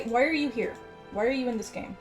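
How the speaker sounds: usual speaking voice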